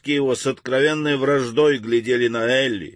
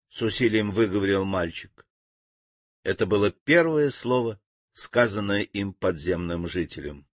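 plain reading voice

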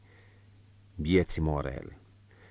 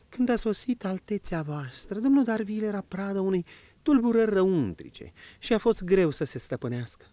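higher, lighter woman's voice, confiding; marchioness's voice; 13 semitones higher than usual. Acted. Marchioness confiding to the prince, in a higher woman's voice.

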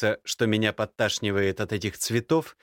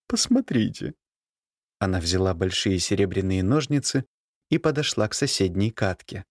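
countess voice; 7 semitones higher than usual. A narrator's reading. Countess voiced, slow higher.

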